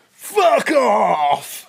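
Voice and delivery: screamed at full blast, high and loud; hammed-up rave yell into a friend's face; mock rave shout. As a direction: yell it right in his face like a rave chav. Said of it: "I get down on, like, one knee".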